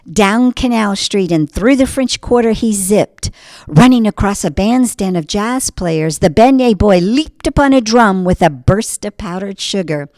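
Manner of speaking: ordinary delivery, nothing marked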